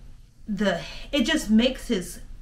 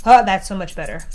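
relieved; 9 dB louder, faster than usual. Real, not acted. Trying the new holders.

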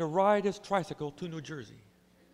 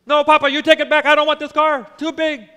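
put-on loud, high child's refusal; stubborn little boy shouting back; defiant child; 12 semitones higher, 16 dB louder than usual. Boy refusing car to father.

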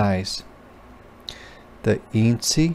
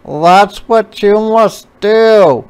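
high, loud, slow beetle voice; beetle calling; 10 semitones higher, 8 dB louder, slower than usual. A narrator's reading. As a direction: call instructions across the water. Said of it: "called the beetle".

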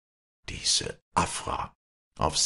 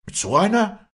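very high, loud echo; high-pitched outraged question; indignant shriek; 7 dB louder than usual. A narrator's reading. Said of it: "schrie das Mädchen".

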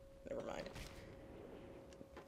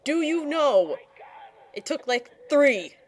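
exasperated scolding; 5 semitones higher, 6 dB louder than usual. Real, not acted. Scolding the rescued character.

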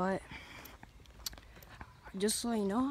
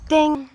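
loud high ding sound effect; 8 semitones higher, 13 dB louder than usual. Pretend. Sound effect, arriving back.

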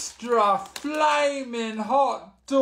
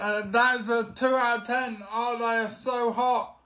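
ordinary delivery

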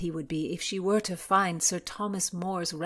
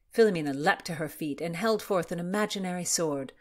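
plain reading voice

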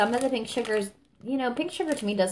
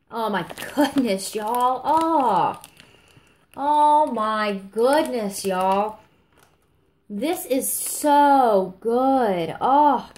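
drawn-out, louder, higher gushing; awed delight; 3 semitones higher, 4 dB louder, much slower than usual. Real, not acted. Reviewer swooning over blackberry jam scent, drawn-out delight. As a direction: savor each word in amazement.

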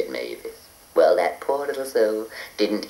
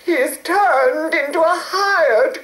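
high, slow, crying lament; tearful fairy; slower than usual. A narrator's reading. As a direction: sob it out like a sad fairy. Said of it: "said Mavis".

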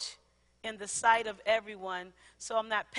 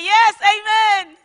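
hammed-up, higher and louder "Yes, amen", slow and drawn out; a mock-eager amen, overdone on purpose; fake amen; 8 semitones higher, 15 dB louder, much slower than usual. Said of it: "That was fake".

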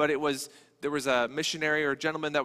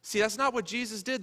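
normal talking voice

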